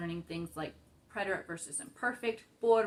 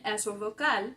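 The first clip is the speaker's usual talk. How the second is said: normal talking voice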